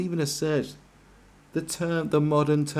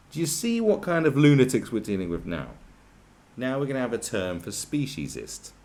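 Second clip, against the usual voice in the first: normal talking voice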